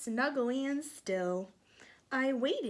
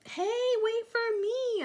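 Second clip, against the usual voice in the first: high, louder, slow call; 13 semitones higher, 7 dB louder than usual. Acted. Acorn calling to the falling leaves. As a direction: call after them, stretched out. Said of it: "I called".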